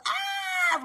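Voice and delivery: mock frazzled yelp, high; frazzled shriek. Imitating a stressed neighbor decorating, frazzled shriek. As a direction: squeal like someone overwhelmed.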